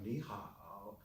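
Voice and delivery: NI HAO low and drawn out, crackling; exaggerated third tone. Shown as a demonstration. Teacher mocking overdone use in the street, exaggerated tone. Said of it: "really exaggerated".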